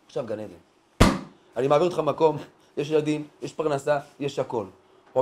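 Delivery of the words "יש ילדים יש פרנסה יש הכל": ordinary delivery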